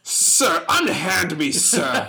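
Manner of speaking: high, loud affronted gentleman; an offended nobleman protesting grandly; hammy stage actor. Acted. Mock-noble exchange, playing affronted lord.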